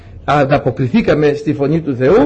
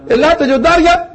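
high-pitched summons, rising at the end; calling out; 11 semitones higher than usual. Acted. Noah summoning animals into the Ark, calling out. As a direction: call the animals over in a high voice.

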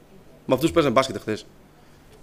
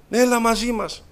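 higher, louder call across the schoolyard; 8 semitones higher, 4 dB louder, slower than usual. Retold or imitated. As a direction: shout the invite to a friend. Gang calling him after school.

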